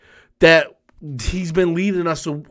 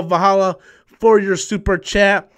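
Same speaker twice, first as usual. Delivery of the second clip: normal talking voice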